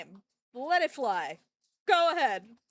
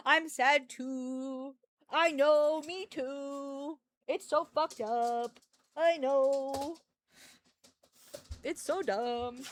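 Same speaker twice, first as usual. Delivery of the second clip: mock sad with dog; 3 dB louder, much slower than usual. Real, not acted.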